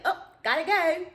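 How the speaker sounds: high, loud "gotta go"; bolting from the room; sudden exit. Retold or imitated. Girl leaving abruptly.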